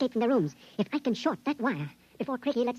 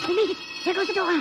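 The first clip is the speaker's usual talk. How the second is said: loud, higher burst of triumph; excited shout; 7 semitones higher, 8 dB louder than usual. Acted. Prisoner cheering as the door springs open, excited shout.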